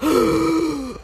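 sharp high gasp of shock; startled reader's gasp; dramatic gasp. Retold or imitated. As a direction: gasp like the plot twist just hit. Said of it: "where I'm just like".

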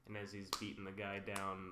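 ordinary delivery

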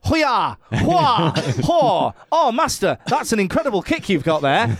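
high-pitched, hammed-up Northern English kung fu dub; mock martial-arts cries in a Northern English accent.

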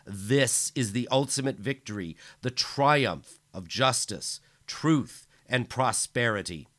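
loud, high rally speech; bellowing. Acted. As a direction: roar it to the stadium crowd.

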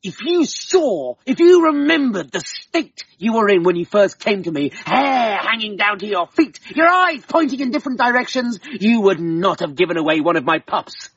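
hammed-up furious husband; angry Dermot. A narrator's reading. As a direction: rage at the woman for giving the pup away. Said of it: "Great anger fell upon him".

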